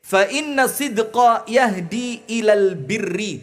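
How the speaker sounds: pembacaan hadis lambat dan berirama; hadith recitation. Acted. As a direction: lantunkan hadis dengan khidmat. (ustadz membacakan hadis kepada jamaah, melantun pelan)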